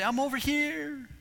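high, slow, far-off calling voice; distant call. Retold or imitated. Believers answering God from afar, high distant call. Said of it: "And we say".

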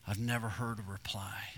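hushed whisper. Retold or imitated. God lamenting unanswered calls, whispered. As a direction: breathe it as a sorrowful whisper.

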